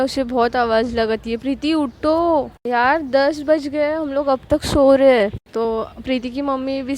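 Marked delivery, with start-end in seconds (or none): 1.4-5.3 raised voice, slow on her name, calling her awake. Acted. Ananya calling sleeping Preeti to get up, loud wake-up call.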